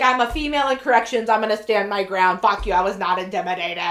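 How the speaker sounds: loud, hammed-up indignant retort; mock defiant. Female officer insisting she holds her ground, loud mock defiance. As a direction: stand your ground loudly, half joking.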